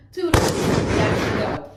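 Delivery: breezy, loud, high sign-off; airy, teasing see-you-later; playful goodbye. Real, not acted. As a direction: toss off a cheeky farewell as you leave. Signing off playfully, leaving abruptly.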